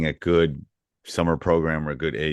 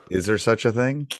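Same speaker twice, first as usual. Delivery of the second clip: mock-doubtful question, pitched higher; sarcastic; 6 semitones higher than usual. Real, not acted. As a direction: feign surprise with playful sarcasm. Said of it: "I'm being sarcastic".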